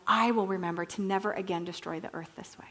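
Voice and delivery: soft, solemn promise fading to a whisper; quiet, near-whispered pledge; hushed vow. Acted. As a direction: repeat the promise quietly, letting it fade away. God's vow repeated, dropping to hush.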